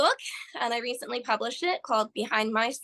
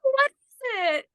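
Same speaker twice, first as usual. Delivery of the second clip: high, loud mock-curious nurse; peppy nurse puzzled; 13 semitones higher, 3 dB louder than usual. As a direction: chirp the question like the clueless nurse. Retold peppy nurse asks, puzzled.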